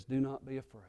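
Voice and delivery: soft, gentle whisper; whispered comfort. Retold or imitated. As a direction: breathe it to a frightened man. Jesus comforting kneeling Simon.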